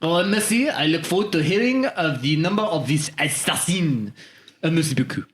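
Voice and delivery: put-on polite French-accented sign-off; satisfied French customer. French customer ending the call with the shop, satisfied accent. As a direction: thank them as a French caller.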